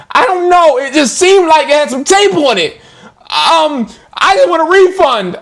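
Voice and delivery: high fake-innocent customer imitation; mock customer voice. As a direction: say it high, all innocent and vague. Mimicking scamming customer.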